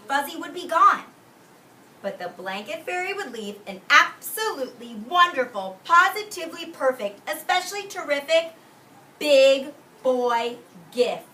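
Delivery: high, loud, slowing to the big gift; building excitement. A narrator's reading. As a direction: sell the gift like a big surprise.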